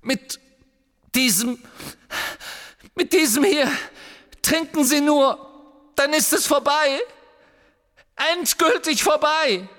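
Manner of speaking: high, loud desperate promise; frantic urging. Acted. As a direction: plead urgently, voice pushed up high. Harry frantically urges him to drink. (verzweifelt drängend, mit hoher Stimme)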